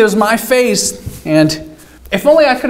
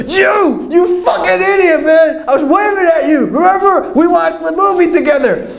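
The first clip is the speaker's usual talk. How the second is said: mock angry girl; 9 semitones higher than usual. Girl scolding him for ignoring her wave, high and indignant.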